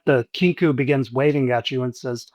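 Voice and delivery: ordinary talk, nothing marked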